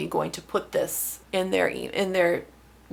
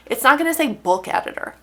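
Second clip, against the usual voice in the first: ordinary delivery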